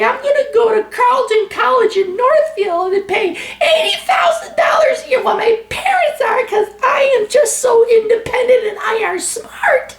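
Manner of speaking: put-on high, whiny parody of a spoiled college girl; mocking millennial girl. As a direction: sneer at a privileged girl in falsetto.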